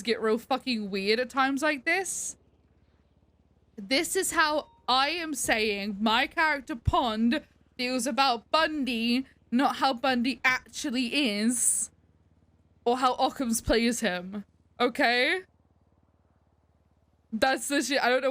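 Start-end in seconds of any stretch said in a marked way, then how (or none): 3.8-14.4 spelled out slowly, each point weighted; laying it down so nobody misreads it; deliberate emphatic clarification; slow, emphatic disclaimer. Real, not acted. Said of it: "I hate that I even have to fucking say this".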